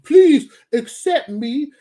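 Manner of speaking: loud, high, drawn-out begging; mock pleading. Mocking those wanting acceptance, exaggerated begging. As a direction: stretch it into a hammed-up plea.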